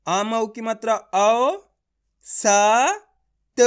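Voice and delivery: औ, स, त each drawn out separately; letter by letter. Shown as a demonstration. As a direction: spell it slowly, pausing between औ, स and त. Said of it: "एक एक अक्षर का उच्चारण करके".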